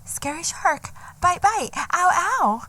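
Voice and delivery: high, cutesy mock food review; TikTok girl voice. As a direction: coo like a TikTok eater. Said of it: "Be like".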